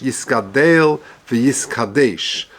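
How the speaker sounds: Yiskadel v'Yiskadesh slowly, with a clear tsere "ey" on the last vowels; tsere pronunciation. Shown as a demonstration. Teacher showing the class the Mishna Berura's reading, slow and exact. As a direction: draw out "Yiskadel v'Yiskadesh" so the "-del" and "-desh" ring as "-deyl" and "-deysh". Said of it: "Nusach HaKadosh".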